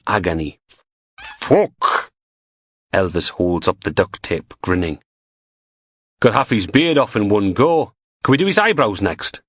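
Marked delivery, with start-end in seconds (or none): 1.4-2.1 a sudden, much higher, louder yelp; Graham yelling as the tape rips off his beard, high and loud; cry of pain. Acted. Sharp pained curse. 6.2-9.4 a lightly voiced grinning henchman; gleeful Geordie thug. Chuckling menace.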